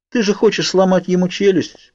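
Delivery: high-pitched sisterly needling; teasing. A narrator's reading. Sister needling her brother about a fight, teasing. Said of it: "поддела брата Элис".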